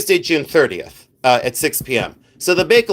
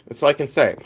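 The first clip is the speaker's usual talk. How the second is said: usual speaking voice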